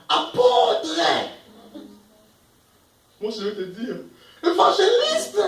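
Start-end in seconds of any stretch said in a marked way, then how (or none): 0.1-1.0 high, loud shout of a grand title; mock proclamation. Preacher mocking the ranking of apostle over evangelist, high proclaiming shout. 4.4-5.4 very high, loud shouted title; mock proclamation. Preacher answering "apostle" with his own title, high mock shout.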